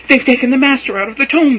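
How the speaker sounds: high, loud desperate cry; frantic distressed wail; cried out. Acted. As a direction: cry it out in panic. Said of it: "she cried".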